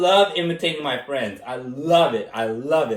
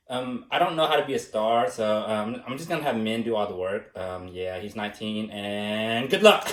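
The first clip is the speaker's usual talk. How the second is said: low, quieter, mumbling DeRosa impression; imitating DeRosa; 8 semitones lower, 4 dB quieter than usual. Imitating DeRosa passing off the work, low mumbling impression. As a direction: drop low and mumble it lazily. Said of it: "here's my imitation of DeRosa".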